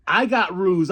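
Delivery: ordinary talk, nothing marked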